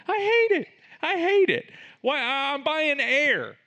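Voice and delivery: high, loud comic exasperation; exasperated outburst. Real, not acted. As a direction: explode over paying for air. Exasperated rant about paying for air.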